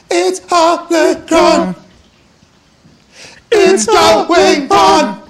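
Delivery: slow, drawn-out, high shouted chant; wrestling promo. Acted. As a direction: belt it out like a ring announcer. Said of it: "It was a cut promo almost, like a wrestling promo".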